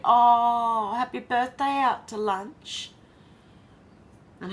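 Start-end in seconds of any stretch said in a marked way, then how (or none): 0.1-2.9 higher, louder, drawn-out congratulations; gushing "oh", stretched happy greeting; delighted birthday wish. Real, not acted. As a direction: send a big birthday cheer. Warm birthday wish to a chatter.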